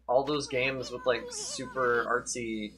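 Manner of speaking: ordinary talk, nothing marked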